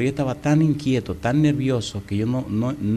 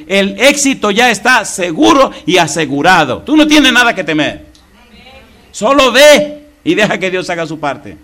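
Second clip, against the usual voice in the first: loud, high, urgent preaching; preaching at full force; 8 semitones higher, 7 dB louder than usual. Real, not acted. Preacher to his church, loud and high. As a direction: drive it home to the congregation.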